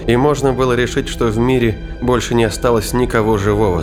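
ordinary talk

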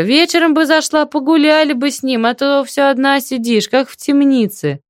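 high-pitched friendly auntie voice; eager neighbourly invitation in a high voice; joyful chatter. A narrator's reading. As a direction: chatter gladly, pitched well up. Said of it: "радостно отвечала тётка".

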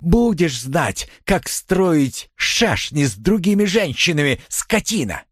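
high, drawn-out angry tirade; jealous fury. A narrator's reading. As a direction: scold him while pummelling him. Enraged wife beating her husband.

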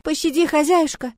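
high theatrical plea for mercy; hammy stage actor. A narrator's reading. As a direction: overplay the begging like a ham. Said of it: "Он картинно упал на колени".

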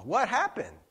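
usual speaking voice

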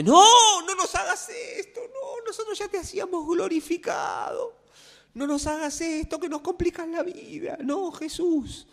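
comic mock disciples whining high; panicked disciples. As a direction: beg Jesus not to be human, hammed-up. Disciples refuse Jesus' flesh.

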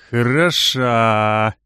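slow, low drawn-out praise; through a smile. A narrator's reading. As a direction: squeeze it out through a grin. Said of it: "процедил сквозь улыбку Рэмко".

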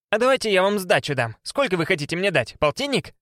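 perky, unabashed beggar woman; brisk and businesslike, no shame. A narrator's reading. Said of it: "она бодро проговорила".

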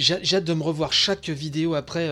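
usual speaking voice

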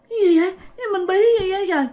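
high, hurried, bewildered fright; acted panic. Girl cannot call out for help, high acted panic. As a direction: blurt it out in alarm.

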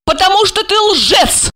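high, upset woman's outburst; high-pitched accusing cry; blurted accusation. A narrator's reading. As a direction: blurt it out on the verge of tears. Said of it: "выпалила она и заплакала".

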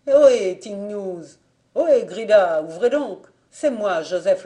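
appel à tue-tête vers la maison; appel crié. Acted. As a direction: crier pour réveiller l'aubergiste. Appelle l'aubergiste à la porte. (Joseph hèle l'aubergiste endormi, beaucoup plus fort)

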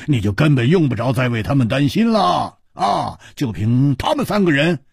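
punctuate a confident brag with "啊"; Hu Tiehua, blustering reassurance. Acted. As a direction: brush off a friend's worry with bluster. Plays Hu Tiehua boasting.

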